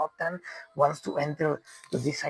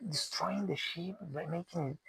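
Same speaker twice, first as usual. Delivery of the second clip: usual speaking voice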